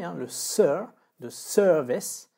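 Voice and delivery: sir of service stretched and loud; slow, stressed sir. Shown as a demonstration. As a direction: lean hard on the sir in service. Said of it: "c'est la première syllabe qui est accentuée".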